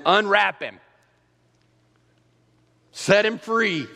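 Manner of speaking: high, loud proclamation; ringing command. Acted. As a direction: release him with a loud cry. Jesus frees Lazarus.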